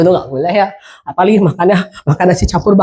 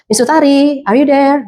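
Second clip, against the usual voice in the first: raised, lilting call to a silent caller; checking on caller; 6 semitones higher than usual. Shown as a demonstration. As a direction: call out higher to check someone is still on the line. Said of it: "nggak ada respon". (memanggil tamu dengan nada naik)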